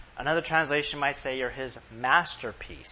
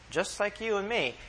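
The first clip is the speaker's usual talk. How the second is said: normal talking voice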